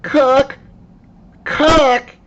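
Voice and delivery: high, loud summoning princess; calling the cook. Acted. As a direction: shout for the cook, high and bossy.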